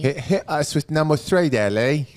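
bad Cockney accent, high and loud; hammed-up London Cockney. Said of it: "That's a terrible Cockney accent".

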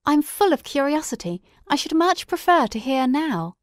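bursting with curiosity; eager Watson. A narrator's reading.

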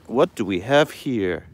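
ordinary delivery

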